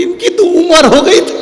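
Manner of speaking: high, wailing lament with a hint of relief; hammed-up grieving wife; mock wailing widow. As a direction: wail it like a widow who isn't that sorry. Widow wails over husband.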